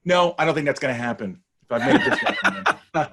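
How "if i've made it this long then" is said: laughs through the words, pitch jumping up; laughing. Real, not acted. Guest laughing at the host about keeping his American accent. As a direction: chuckle while you say it.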